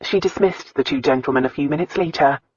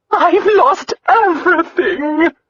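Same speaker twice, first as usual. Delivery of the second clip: high, loud, drawn-out wail; 6 dB louder, slower than usual. A narrator's reading. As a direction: wail it slowly in despair. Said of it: "she wailed".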